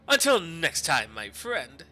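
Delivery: booming, hearty German merchant; big jovial German voice; jolly German accent. Acted. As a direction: greet a rival like an old friend, loudly. Goldschmidt farewell.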